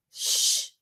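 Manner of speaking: unvoiced shush; hushing. Ford hushing Arthur to hear the announcement. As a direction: hush him quickly. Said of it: "said Ford".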